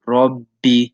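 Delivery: "Rabbi" with the ba held long, as if said twice; doubled ba. Shown as a demonstration. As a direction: hold the b in "Rabbi". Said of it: "you hold it".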